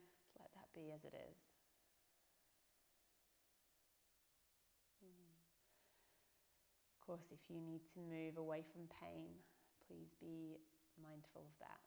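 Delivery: unhurried body-scan meditation voice with long pauses; guided meditation. Real, not acted. Yoga teacher leading listeners through a body scan, calm meditation voice. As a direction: guide them gently into the body, leaving space between phrases.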